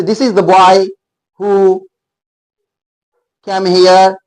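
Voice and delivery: put-on this is the boy, "the" said as a stressed "thee", slowly; slow example sentence stressing "the". Shown as a demonstration. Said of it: "उस पे जोर पड़ता हो". (धीरे, "the" पर जोर देकर)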